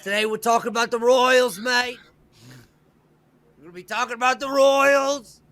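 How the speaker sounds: loud, high mock Cockney greeting; hammed-up London geezer accent; over-the-top British accent, pitched well up; terrible Cockney accent. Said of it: "Please excuse my, terrible accent there".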